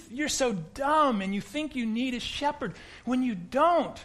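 high, loud know-it-all voice; mock superior atheist. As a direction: lecture the dumb sheep from on high. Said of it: "So what he's saying is".

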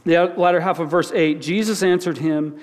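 ordinary talk

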